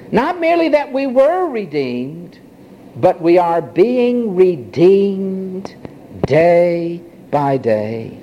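usual speaking voice

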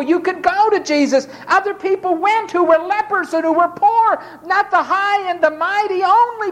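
high, rising preacher's cry; fervent preaching. Acted. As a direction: lift the crowd line by line. Preacher builds to a climax.

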